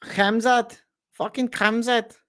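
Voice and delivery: high mock Scouse with a throaty kh; Darren Till. Fighter naming his rival, high mock Scouse accent. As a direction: spit the name in a high fake Scouse accent. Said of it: "The worst Darren Till accent of all time".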